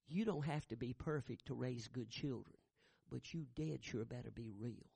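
ordinary talk

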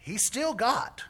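high, loud emphasis on still; preacher's rising emphatic declaration; emphatic preaching. Real, not acted. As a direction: drive the word still home from the pulpit. Preacher driving home his point.

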